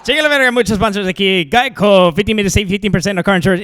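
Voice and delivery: loud rapid sponsor plug. Retold or imitated. Comic mocking a Mexican announcer squeezing in sponsors, loud and rushed. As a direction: shout the ad read fast like a radio announcer. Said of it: "stereotypical Mexican announcer like".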